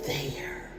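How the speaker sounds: barely whispered, breath only. Real, not acted. Whispering in shock about her dad's death, barely audible. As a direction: let the word fade to a whisper.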